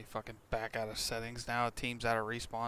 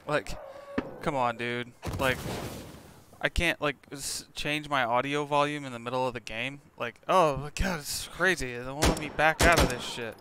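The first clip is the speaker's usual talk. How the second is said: raging gamer; 3 semitones higher, 8 dB louder than usual. Real, not acted. Frustrated rant at game settings.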